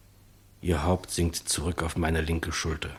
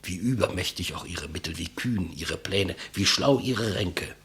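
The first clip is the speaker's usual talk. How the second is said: grave, measured speech to his killer; solemn declamation; 3 semitones higher than usual. Acted. Condemned man addressing his executioner, solemn and measured. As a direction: speak like a last testament.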